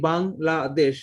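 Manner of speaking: Bangladesh slowly split into বাং, লা, দেশ; syllable by syllable. Shown as a demonstration. Teacher repeating the example word, Bangladesh in three separated syllables. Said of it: "তিনটা syllable উচ্চারণ করবে".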